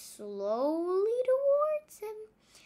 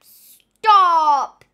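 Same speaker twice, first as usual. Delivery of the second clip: a full, much louder bellow; 3 semitones higher, 16 dB louder than usual. A narrator's reading. Captain Pepper waking from his nap, bellowing loud. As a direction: roar the word like a woken captain. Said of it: "he bellowed".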